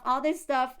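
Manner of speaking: ordinary talk, nothing marked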